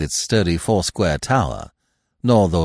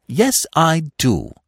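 stoutly; 8 semitones higher, slower than usual. A narrator's reading. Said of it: "said Freddy stoutly".

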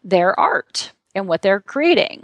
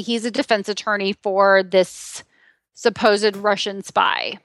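ordinary talk, nothing marked

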